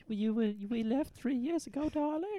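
high, hammed-up English accent; mock English lady; Penny's accent. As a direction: play a doting wife in a bad high English accent. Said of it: "this accent's really getting away from us".

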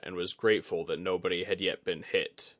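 ordinary delivery, nothing marked

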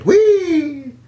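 high mock-excited whee; sarcastic whee. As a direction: squeal it with fake glee. Sarcastic cheer about Six Flags trip.

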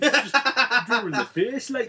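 laughing Geordie imitation; Geordie accent. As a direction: put on a comic Newcastle accent. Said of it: "it's a funny accent to say things in".

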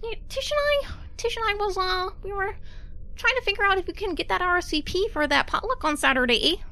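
put-on high, fast chipper mail boy voice; Canadian mail boy. GM voicing Cliff.

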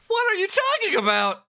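very high, loud squawk; baffled outburst. Pretend. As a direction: yelp it in exaggerated disbelief. Mock outburst as baffled hero.

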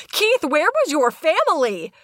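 slow, mock-worried question; mock concern. Real, not acted. Mock concern for the teen camper.

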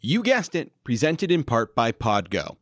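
upbeat sponsor read. Acted. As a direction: read it like a podcast ad.

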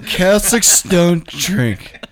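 high, loud and drawn out; incredulous echo. Retold or imitated. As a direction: stretch it out in shocked disbelief. Echoing the claim in disbelief.

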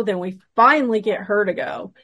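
ordinary talk, nothing marked

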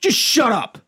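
high, mock tantrum shouting "shut up"; heated family shouting. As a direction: play a bratty host yelling at everyone. Mock bossy podcast host rant.

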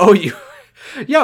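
laughing through words. Real, not acted. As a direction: breaks into laughter. Laughing reaction.

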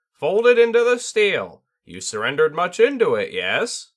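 high, loud squawk of a bird spirit; squawking tengu. A narrator's reading. Tengu squawking at his student over the blade. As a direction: squawk it out like a great crow. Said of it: "the Tengu squawked".

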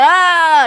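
high, loud fed-up ah; a quick exasperated yelp before logging off; mock exasperated ah. Scrolling past trash posts, high fed-up ah. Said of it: "I just be like".

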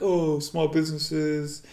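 slow, drawn-out mild mockery; mocking stupid posts. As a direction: lightly mimic a plaintive online post, slowly. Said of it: "stupid ass posts like".

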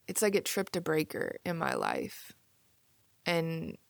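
ordinary talk

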